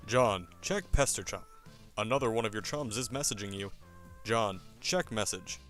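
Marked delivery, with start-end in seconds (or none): none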